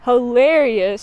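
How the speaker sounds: normal talking voice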